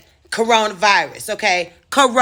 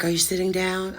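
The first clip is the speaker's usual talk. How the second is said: softer, careful warning; grave quiet question; 6 dB quieter than usual. Retold or imitated. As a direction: ask gently before delivering bad news. Boss preparing bad news.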